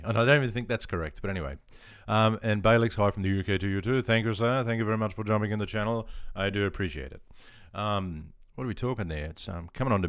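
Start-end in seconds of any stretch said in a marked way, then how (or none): none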